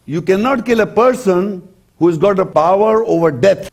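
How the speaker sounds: usual speaking voice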